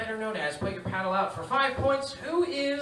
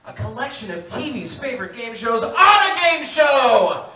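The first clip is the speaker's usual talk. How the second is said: loud, bright host patter; game show host; 3 semitones higher, 7 dB louder than usual. Acted. Host welcoming viewers, big announcer read.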